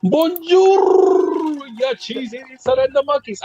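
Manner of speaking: high, bad Scottish accent; a failed stab at a Scottish brogue. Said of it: "I cannot do a Scottish accent to save my life".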